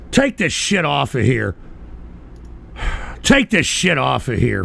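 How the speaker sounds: ordinary delivery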